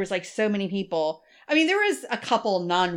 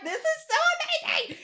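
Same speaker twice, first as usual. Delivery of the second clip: high-pitched, laughing gush; laughing awe. Retold or imitated. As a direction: squeal with delight through a laugh. She retells her own awe.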